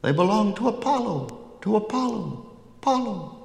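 very high-pitched crow voice; seething crows. A narrator's reading. Crows call out whose cows they are, very high crow voices. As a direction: echo it like a flock of crows, far up high. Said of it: "crows began to seethe and whistle".

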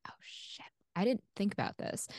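whispered "oh shit" under her breath; panicked whisper. Retold or imitated. Caller caught off guard by the secretary, whispering to herself. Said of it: "And I'm like".

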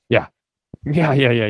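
usual speaking voice

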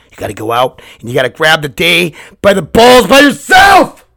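a pep talk that erupts into hammed-up shouting; building motivational shout. Host urges listeners to seize the day, building shout. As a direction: climb in pitch and volume to a full yell.

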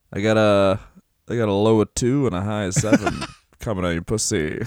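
low, fast, sleazy TV weather voice; horny weatherman. Retold or imitated. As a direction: read the forecast like a lecherous weatherman. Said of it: "my impression of a horny weatherman".

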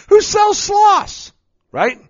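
high, slow laughing question; laughing disbelief. Real, not acted. Dad laughing at his own sloth joke, high and slow. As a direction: laugh through "Who sells sloths".

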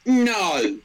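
high-pitched coach correcting someone. Acted. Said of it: "like me when I'm coaching then".

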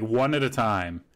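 normal talking voice

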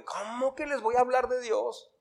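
high, soft indignant protest as Jonah; mock indignation. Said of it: "le daba mucho coraje".

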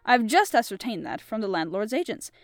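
measured audiobook reading with character voices; storybook reading voice; story narration. A narrator's reading. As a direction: tell the tale steadily to listeners. Reads the story aloud.

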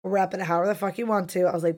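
ordinary talk

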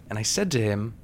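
normal talking voice

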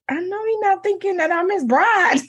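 high, disbelieving quoted thought; incredulous. Retold or imitated. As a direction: act like you can't believe he means it. Disbelief at the man's profile deletion.

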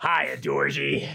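slow, drawn-out creepy clown voice; creepy clown greeting. Acted. Friends' quote game, a creepy clown greeting. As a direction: lure them in like a sewer clown. Quoting It's Pennywise.

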